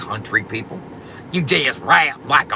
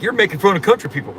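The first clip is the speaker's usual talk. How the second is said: quick, raised mock whine; mocking the rival; 8 semitones higher, 4 dB louder, faster than usual. Mocking the rival rapper's complaint, quick mock whine. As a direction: mimic his offended complaint, higher and fast. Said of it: "And he's like".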